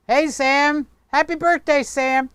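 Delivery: high, loud birthday greeting; bright sing-song hello; cheery greeting. Real, not acted. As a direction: call out happily to a friend. Greeting friend on camera.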